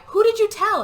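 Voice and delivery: high-pitched retold demand; mock accusation. Maddie questions Tyler about the secret, high mock accusation.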